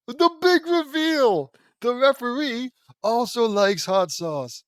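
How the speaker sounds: high, loud, drawn-out proclamation; mock-dramatic announcement. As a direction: proclaim it slowly like a huge plot twist. Mock-dramatic comment on a twist.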